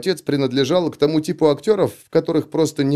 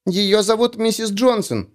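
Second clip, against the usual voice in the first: put-on high squeaky four-year-old girl; squeaky little girl voice; 8 semitones higher than usual. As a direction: pipe it up like a tiny girl. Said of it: "писклявым голоском четырёхлетней девочки".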